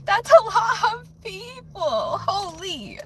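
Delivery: emotional disbelief at the number; overwhelmed. Real, not acted. Streamer overwhelmed by two thousand subscribers, teary. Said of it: "I would be blushing the whole time".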